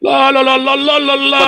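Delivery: sing-song taunt of triumphant supporters; mocking cheer. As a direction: parody the cheering crowd, pitched well up. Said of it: "Katanya gitu, Allahu Akbar".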